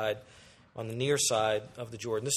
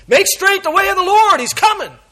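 much higher, loud herald shout; herald's cry; 14 dB louder than usual. Acted. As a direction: announce the coming king. Herald running ahead, yelling.

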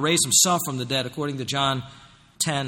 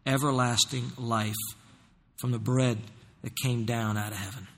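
closing prayer; 5 semitones lower, 4 dB quieter than usual. Acted. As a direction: pray softly to God for the flock.

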